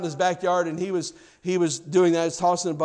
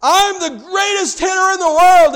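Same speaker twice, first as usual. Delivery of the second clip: put-on boy's shout; 13 semitones higher, 12 dB louder than usual. A narrator's reading. Little boy in his backyard shouting his boast. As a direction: shout it like a kid in the World Series. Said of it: "he shouted again".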